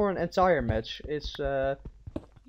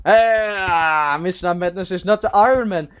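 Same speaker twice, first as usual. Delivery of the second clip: excited shout; 3 semitones higher, 9 dB louder than usual. Real, not acted. Excited over game stats.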